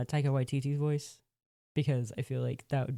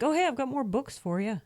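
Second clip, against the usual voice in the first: cheerful scribe; 10 semitones higher than usual. Retold or imitated. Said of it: "he's like".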